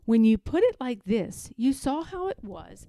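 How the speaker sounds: ordinary delivery, nothing marked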